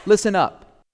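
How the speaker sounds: mildly forceful call to listen; calling for attention. Acted. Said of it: "And it means".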